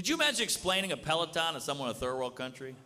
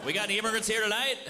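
calling out to crowd; 8 semitones higher than usual. Real, not acted. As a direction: hail the audience from the stage. Calling out to the audience.

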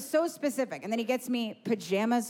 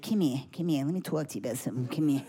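lower, hushed father-in-law, beckoning; 9 semitones lower than usual. Retold or imitated. Father-in-law pulls her aside.

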